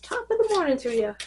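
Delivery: put-on quick, high playful Irish accent; lighthearted fake Irish lilt; mock Irish accent. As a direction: toss off a cheesy Irish greeting. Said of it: "break out into some sort of accent".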